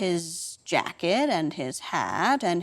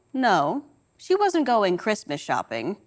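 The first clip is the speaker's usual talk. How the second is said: plain reading voice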